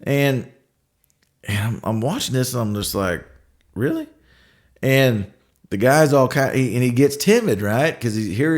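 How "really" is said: high, disbelieving "Really?"; a sharp rising disbelief; incredulous. Retold or imitated. Watching a coworker belittle a contractor, incredulous.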